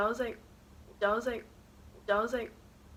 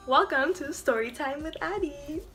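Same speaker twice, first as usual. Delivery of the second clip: show intro; 5 semitones higher than usual. Acted. Vlog show intro catchphrase.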